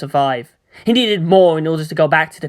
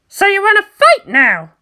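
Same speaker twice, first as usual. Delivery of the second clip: much higher, louder cocky taunt; grinning challenge; 5 dB louder than usual. Acted. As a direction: dare the enemy with a grin. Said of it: "Asuka grinned".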